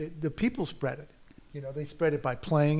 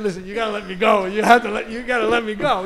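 higher, louder, rushed and laughing; mock pleading; 7 semitones higher, 10 dB louder than usual. Actor begging the butcher to let him go, loud and laughing. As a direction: beg to be let go.

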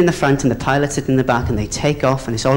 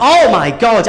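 raised, mildly hammed-up alarm; a higher mock-horrified gasp of words; alarmed exclamation; 6 semitones higher, 4 dB louder, slower than usual. Stewardess overhearing the captain's plan, mock alarm.

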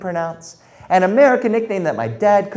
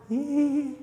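scared squeak; 10 semitones higher, 6 dB quieter than usual. Acted. Scared squeak at third graders.

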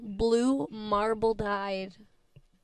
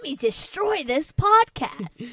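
dog's voice; 6 semitones higher, 5 dB louder, slower than usual. Retold or imitated. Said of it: "It's like".

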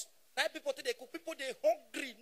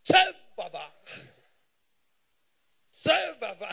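loud, punchy mock hail; hammed-up loud hailing of an elder; retold greeting; 9 dB louder than usual. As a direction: call out to him loudly. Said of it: "Na me come go meet am".

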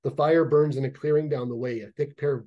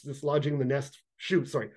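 ordinary delivery, nothing marked

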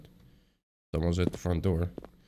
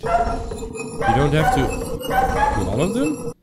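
high, slow, incredulous question; surprised question; 8 semitones higher, much slower than usual. Real, not acted. Gamer realising the puzzle rule, slow surprised rise. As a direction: stretch it out in disbelief.

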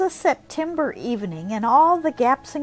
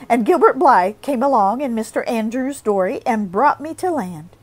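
plain reading voice

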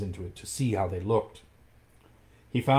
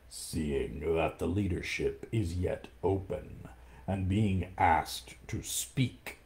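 low, slow, soft menacing purr; tiger's voice; 3 semitones lower, 5 dB quieter, slower than usual. Acted. Shere Khan addresses the pack, low, slow and smug. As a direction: drag the words out like a smug tiger. Tiger begins his speech.

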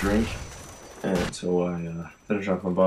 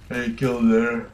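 talking through a yawn, higher pitched; real yawn; 5 semitones higher, 4 dB louder than usual. Real, not acted. Gamer up at four in the morning, yawning while commentating. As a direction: let a tired yawn stretch the words. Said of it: "I'm yawning".

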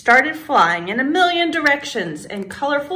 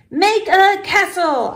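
high, emphatic command; 9 semitones higher, 3 dB louder than usual. A narrator's reading. Girl ordering the wand to build, commanding. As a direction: order the wand firmly, pitched up. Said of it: "I commanded".

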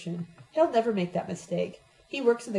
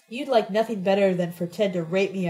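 plain reading voice

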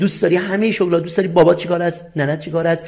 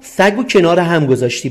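usual speaking voice

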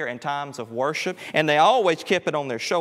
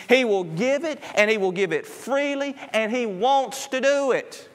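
higher, building preacher's call; full-force preaching; 7 semitones higher, 3 dB louder than usual. Acted. Preacher urging the congregation that Jesus heals, fervent. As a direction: lift the congregation with rising fervor.

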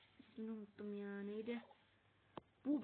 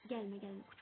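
ordinary talk

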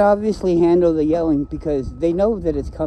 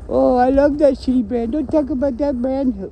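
high mocking voice; mock brand fan; 10 semitones higher than usual. Mocking a phone brand fan, high and sing-song. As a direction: parody a defensive fanboy.

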